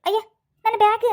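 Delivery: panicked. Acted. Woman discovers her bag stolen.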